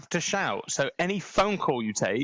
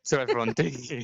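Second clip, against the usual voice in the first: ordinary talk, nothing marked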